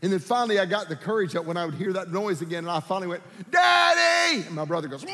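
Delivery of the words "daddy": put-on loud, high-pitched yell like a scared child; sudden acted shriek, high and drawn out; calling for Dad. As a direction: cry out for Daddy across a dark house. Retold child calling out at night.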